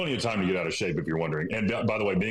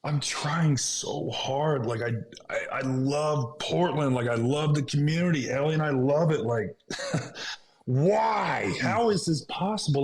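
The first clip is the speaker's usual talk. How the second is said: high, strained pleading; anguished plea; 7 semitones higher, slower than usual. Real, not acted. Player grieving his trade away from Portland, anguished high plea. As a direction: let the hurt break through, rising. Emotional recounting of being traded.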